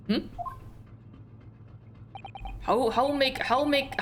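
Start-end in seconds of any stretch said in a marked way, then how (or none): 2.6-4.0 fumbling for a Russian accent, pitched up; a first rough stab at Russian-accented English; trying a Russian accent. Shown as a demonstration. Player testing a Russian accent for the sailor. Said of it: "how do you sound Russian?".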